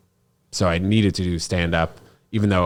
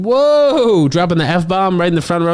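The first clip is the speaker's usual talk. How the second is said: much higher, louder, fast shocked exclaim; shocked whoa; 11 semitones higher, 5 dB louder than usual. Retold or imitated. Retelling the heckle to the host, shocked and fast. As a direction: act floored by the insult.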